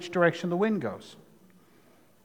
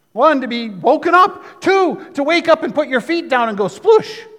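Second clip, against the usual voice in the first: excited; 6 semitones higher, 5 dB louder than usual. Real, not acted. Excited retelling of rude awakening.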